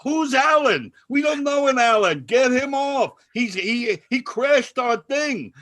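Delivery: high, loud mock outrage; indignant yelling. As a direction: holler as if exposing a gatecrasher. Retold guy repeatedly objecting to Alan.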